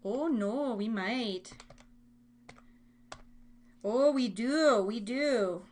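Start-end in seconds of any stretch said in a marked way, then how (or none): none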